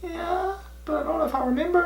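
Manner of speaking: very high, small, soft voice; timid reply way up high; nervous squeak. Retold or imitated. Nervous reply, acting out himself.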